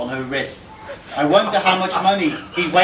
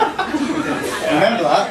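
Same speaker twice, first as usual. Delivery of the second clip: laughing; 4 semitones higher, slower than usual. Real, not acted. Laughing question to brother.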